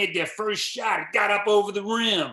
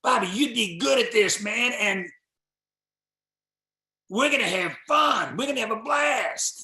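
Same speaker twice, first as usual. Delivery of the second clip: eager invitation; 5 semitones higher, 4 dB louder than usual. Retold or imitated. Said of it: "He says".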